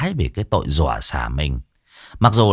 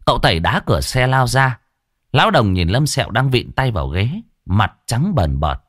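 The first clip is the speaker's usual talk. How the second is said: plain reading voice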